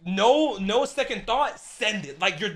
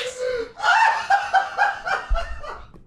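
high, shrieking laughter; incredulous laugh. Real, not acted. Player reacts to a bullshit moment, shrieking laugh. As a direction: laugh yourself hoarse in disbelief. Disbelief at what just happened.

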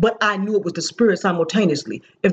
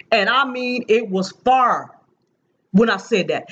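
normal talking voice

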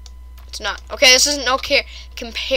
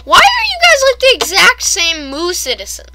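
high, loud burst of disbelief; exasperated question; 7 semitones higher, 9 dB louder than usual. Real, not acted. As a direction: demand loudly, starting very high. Mock outrage at identical villagers.

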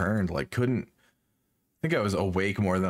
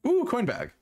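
excited. Real, not acted. Finds a coin bag.

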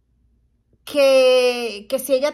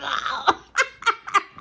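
laughing at her own fright; burst of laughter; 3 semitones higher than usual. Real, not acted. Vlogger laughing at her own hospital scare, real burst of laughter.

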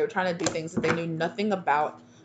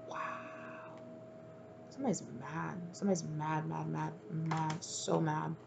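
hushed; 13 dB quieter than usual. Real, not acted. Reader marvelling at ancestors' anger, hushed. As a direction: drop right down and dwell on it.

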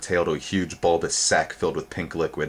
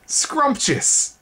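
high, squeaky delighted exclamation; slurped delight; 4 dB louder than usual. A narrator's reading. Twig relishing the fruit, slurping delight. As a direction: savour the fruit out loud like a small boy. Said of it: "he slurped".

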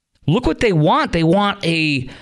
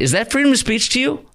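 indignant question; 10 semitones higher than usual. Real, not acted. Host outraged by campus chants.

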